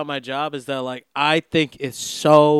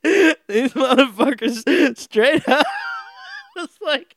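slow, higher, breaking into laughter; laughing; 10 semitones higher, slower than usual. Real, not acted. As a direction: talk through the laugh. Laughing at the taxed bonus.

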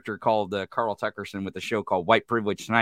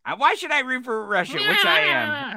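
mock pundit squeak. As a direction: draw it out in a high sneer. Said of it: "repeats the clip".

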